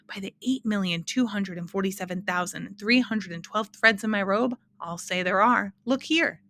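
usual speaking voice

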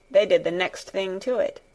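usual speaking voice

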